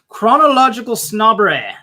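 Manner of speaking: big put-on British accent. Shown as a demonstration. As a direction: proclaim it in a hammed-up British accent. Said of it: "say it with, with an accent".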